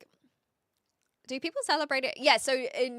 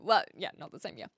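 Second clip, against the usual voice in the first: usual speaking voice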